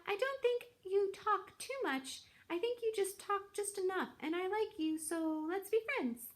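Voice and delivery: high, bright gosling voice. Acted. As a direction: squeak it out like a shy little gosling. Gosling keeps speaking to squirrel.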